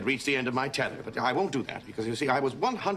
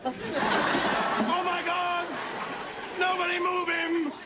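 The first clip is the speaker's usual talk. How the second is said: much higher, theatrical panic; hammed-up alarm; 11 semitones higher, much slower than usual. Hammed-up alarm over fallen brother.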